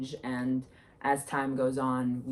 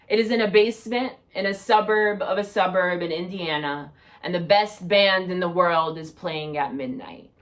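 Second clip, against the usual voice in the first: projected spoken-word recital, louder than chat; poetry reading; 2 semitones higher, 6 dB louder than usual. Acted. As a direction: perform a narrative poem for an audience. Reads second poem aloud.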